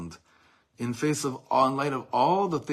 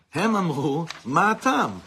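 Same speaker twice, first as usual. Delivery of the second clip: loud recital; 6 semitones higher, 8 dB louder than usual. Acted. Reciting quoted poem in Hebrew.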